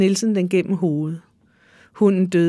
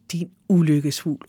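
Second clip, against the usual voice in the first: usual speaking voice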